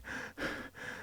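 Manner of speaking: exhausted sigh. Retold or imitated. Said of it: "they're sitting there like this going".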